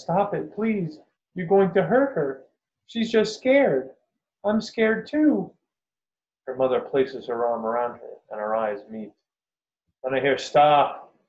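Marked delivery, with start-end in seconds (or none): 0.0-5.5 put-on high, loud little girl's plea; child's pleading shout. A narrator's reading. Scared little girl begs them to spare the deer, shouting. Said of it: "squeezes her stuffed animal and shouts". 10.4-11.1 loud, raised command; booming voice. A narrator's reading. Man walking onto the beach halts the shooting, booming. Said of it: "from this booming voice".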